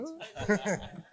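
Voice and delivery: short, high, soft laugh; quiet high-pitched chuckle. Real, not acted. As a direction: let out a light laugh. Laughing at the teasing.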